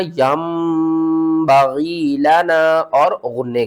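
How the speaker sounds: यंबगी लना slowly with nasal hum on the meem; with ghunna. Shown as a demonstration. Teacher contrasts the wrong यन, slow nasal recitation. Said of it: "यन नहीं पढ़ना".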